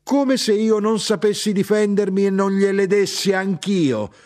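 high, loud, defiant outburst; sustained cry of defiance; indignant shout. A narrator's reading. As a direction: protest proudly at the top of the voice. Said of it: "gridò mia madre".

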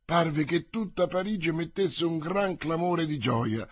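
ordinary delivery

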